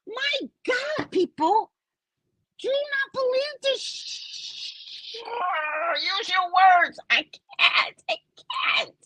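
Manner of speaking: high, drawn-out tearful plea; hammed-up exasperated sobbing; mock crying. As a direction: wail in despair, struggling for words. Too upset at report for words.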